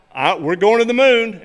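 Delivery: normal talking voice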